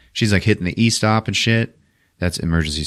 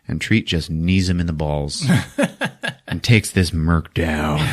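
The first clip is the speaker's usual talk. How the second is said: ordinary delivery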